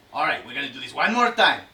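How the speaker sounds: usual speaking voice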